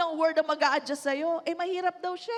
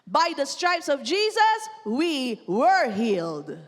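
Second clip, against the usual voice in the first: slow proclamation; 4 dB louder, slower than usual. Acted. Pastor declaring healing to the congregation, slow and solemn. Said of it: "preaching declaration".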